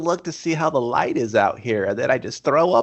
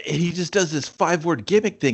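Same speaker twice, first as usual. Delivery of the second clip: ordinary talk, nothing marked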